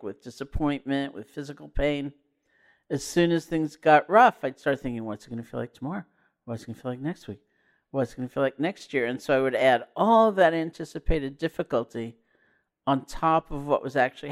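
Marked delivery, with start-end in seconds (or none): 5.0-9.1 quick and hushed, lightly worried; anxious inner questioning. Retold or imitated. Teacher retelling her thoughts when things got rough, quick quiet worry.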